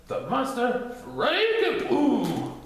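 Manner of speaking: high, very slow grand reveal; drawn-out high theatrical reveal; dramatic announcement. Acted. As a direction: unveil the monster with flourish. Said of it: "responded this irascible Titter".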